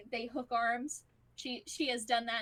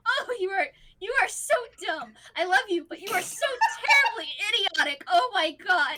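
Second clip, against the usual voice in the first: loud, high-pitched fond exasperation; exasperated scolding; 8 semitones higher, 11 dB louder than usual. Acted. Wife berating husband for risking himself, loud exasperated scolding. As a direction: scold the fool you love.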